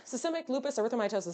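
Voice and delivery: rattled off at top speed; tongue-twister fast. Shown as a demonstration. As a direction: race through the long medical name. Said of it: "Say that three times fast".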